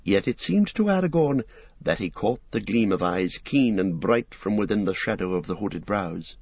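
plain reading voice

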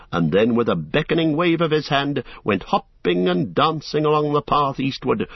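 plain reading voice

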